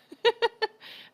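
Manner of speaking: sudden high cackle; loud high laugh. Real, not acted. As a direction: burst of bright laughter. Laugh after bear joke.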